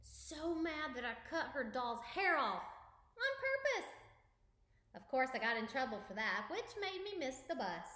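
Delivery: lively storybook narration; reading to children. Acted. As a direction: read it aloud for kids. Reads picture book aloud.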